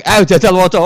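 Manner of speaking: very high, loud order; demanding shout. Acted. Angel ordering Umar to read, shouted high. Mungkar Nakir demanding reading.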